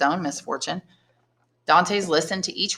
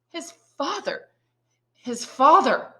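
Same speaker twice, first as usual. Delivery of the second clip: high stunned cry, the second slower; shocked cry; 10 semitones higher, slower than usual. A narrator's reading. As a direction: blurt it out in disbelief. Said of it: "cried Dantès".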